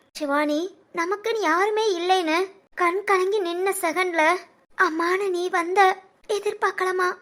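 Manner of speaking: slow, soft, choked with emotion; tearful confession. Acted. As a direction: speak through held-back tears, slowly. Brother tearful gratitude to sister.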